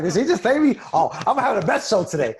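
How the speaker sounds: loud, high, fired-up self-talk; playfully worked-up boast, pitched high; hyped-up mock defiance. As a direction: act stung, then pump yourself up. Acted fired-up reaction to slight.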